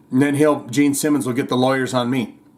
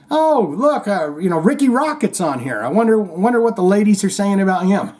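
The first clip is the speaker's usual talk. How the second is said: much higher, nosy gossip voice; mock curious fan; 6 semitones higher than usual. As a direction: tease a nosy fan browsing the site. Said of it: "you're just like".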